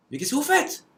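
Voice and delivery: shocked at what he sees; the runner catches the Texan moving his hurdles, in an astonished high cry; surprised runner. Retold or imitated. Runner sees haies being removed.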